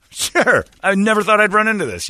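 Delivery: high loud caught-out surprise; surprised. Retold or imitated. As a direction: blurt it out amazed. Surprised self quote.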